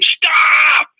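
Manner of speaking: high, loud barked stop; retold scream. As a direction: yell it at the top of your lungs. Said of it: "he literally screamed".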